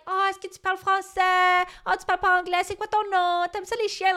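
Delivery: put-on high, sing-song voice for a child; sweet high talk to coax a little boy; chatting to a kid in French. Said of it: "talking to him in French".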